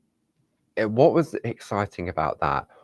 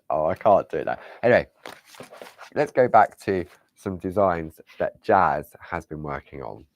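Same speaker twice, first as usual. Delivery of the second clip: normal talking voice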